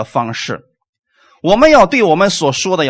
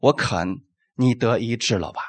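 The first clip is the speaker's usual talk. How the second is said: low, soft, settled voice quoting Jesus; quiet assurance; 6 semitones lower, 8 dB quieter than usual. Retold or imitated. Jesus answers a sick man asking to be cleansed, quietly assured. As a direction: drop low and gentle for a simple promise. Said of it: "耶稣是怎么说话的".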